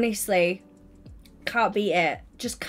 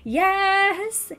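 high, drawn-out celebratory whoop; excited high singsong cheer; weekend cheer; 5 semitones higher, much slower than usual. Real, not acted. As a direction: stretch it out, cheering for Friday.

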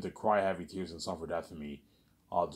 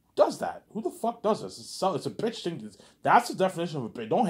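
higher, louder indignant rant; indignant outrage; 8 semitones higher, 5 dB louder than usual. Real, not acted. Husband ranting at his cheating wife, pitch climbing in outrage. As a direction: demand it in disbelief.

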